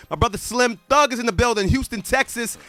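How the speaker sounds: big hype DJ announcer voice; radio announcer. Acted. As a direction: pump up the crowd on air. DJ hypes the live show.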